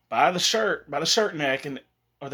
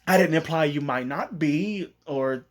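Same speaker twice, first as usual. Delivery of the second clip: high, halting backpedal; acting out a nervous stammer; flustered stutter; 4 semitones higher than usual. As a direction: stumble over the words, freaking out. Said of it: "He's starting to stutter, just freaking out".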